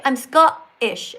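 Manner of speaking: SCOTTISH with a glottal stop for the T; imitating a Scot swallowing the T in Scottish; dropped T. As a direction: say Scottish slowly, leaving a gap where the T goes. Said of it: "instead of saying Scottish, you say".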